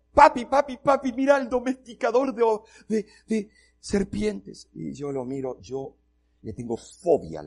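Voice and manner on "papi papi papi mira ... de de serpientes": put-on high, loud, eager little-girl calling; excited daughter. Daughter calls her dad to see the snake charmer, excited. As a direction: squeal for papi like a thrilled child.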